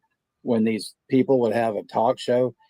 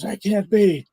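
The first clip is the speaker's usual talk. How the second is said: higher, pleading husband voice; prank caller; 8 semitones higher than usual. Retold or imitated. Prank caller asking the pizza clerk for his wife, pitched-up worried husband. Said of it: "he goes".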